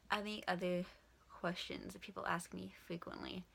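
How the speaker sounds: ordinary talk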